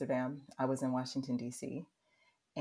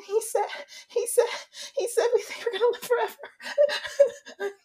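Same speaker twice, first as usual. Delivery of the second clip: very high, loud hammed-up wail; mock sobbing; 6 dB louder than usual. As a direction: blubber it through laughter, breaking off and restarting. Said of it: "the tears welled up in my eyes".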